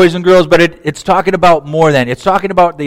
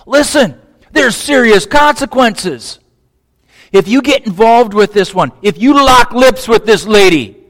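much higher, louder pleading warning; urgent warning; 7 semitones higher, 4 dB louder than usual. Pretend. Father urging his son to stay away from her, high and loud. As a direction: press the danger on him urgently.

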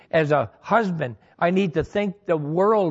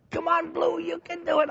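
much higher, softer, hammed-up coaxing voice; mock cheerleader coaxing; 11 semitones higher, 4 dB quieter than usual. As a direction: egg on the soap ball in a silly high voice. Coaxing soap to pull blue.

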